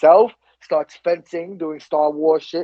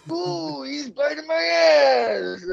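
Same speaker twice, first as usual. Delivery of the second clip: much higher, slow, strained comic yelp; mock pained yelp; 8 semitones higher, 4 dB quieter, much slower than usual. Macho Man bitten by the police dog, yelping in mock pain. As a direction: cry out as the dog bites.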